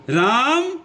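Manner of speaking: राम called out high and loud, vowel held long; pluta call. Shown as a demonstration. Teacher demonstrating pluta to pupils, high loud drawn-out राम. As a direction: call राम as if across a field, stretching the आ. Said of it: "इसको हम ऐसे भी कह सकते हैं".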